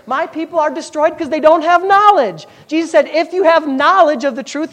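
high, loud preacher's build; rising revival preaching; fiery preaching. Acted. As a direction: preach it out to the congregation. Preaching at full force.

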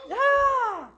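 a high, loud, held yell of triumph; triumphant yell. Real, not acted. Pastor to congregation after his climax, high triumphant yell. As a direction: shout it out in victory.

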